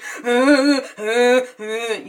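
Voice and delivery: loud mock panicky whimpering; freaking-out whimper. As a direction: imitate someone whimpering in panic. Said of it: "she freaking out".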